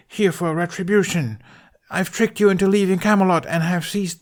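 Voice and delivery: a high-voiced stage villain throwing down the challenge; hammy villain challenge. Tooth plays Mordred.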